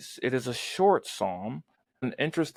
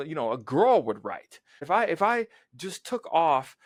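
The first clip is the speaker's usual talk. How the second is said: ordinary talk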